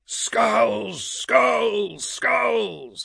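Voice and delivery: high, drawn-out chanting howl. A narrator's reading. Warriors echo Orto, howling. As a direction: howl each word as a war chant. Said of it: "howled the others".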